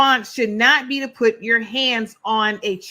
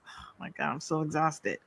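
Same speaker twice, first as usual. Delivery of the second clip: drained, breathy whisper, much softer; exhausted whisper; 6 semitones lower, 13 dB quieter than usual. Real, not acted. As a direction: sigh it out, spent and fed up. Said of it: "I said I'm exhausted".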